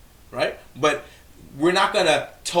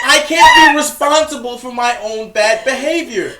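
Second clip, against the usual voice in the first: mock crying; 10 semitones higher, 10 dB louder than usual. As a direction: fake sobbing whiny kid. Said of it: "sounded like a whining kindergartner".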